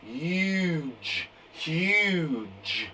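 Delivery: Huge said slowly and softly, stretching the U; teacher modelling Huge slowly for the class to copy; slow model word. Shown as a demonstration. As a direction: model Huge sound by sound, drawing out the U. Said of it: "I'll go first, then you go".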